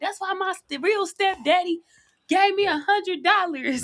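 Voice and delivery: high-pitched imitation of a stepkid; mock bragging kid. Host mimicking kids bragging to the new guy, high mock-kid voice. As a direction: put on a high voice, crowing about stepdaddy. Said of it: "Then they'll be like".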